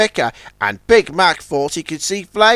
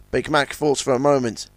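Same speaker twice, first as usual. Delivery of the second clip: ordinary delivery, nothing marked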